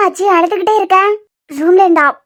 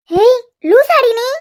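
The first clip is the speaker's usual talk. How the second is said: shocked scolding; 4 semitones higher, much slower than usual. Acted. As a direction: stretch it out in disbelief. Friend scolds Jessica's suicide plan.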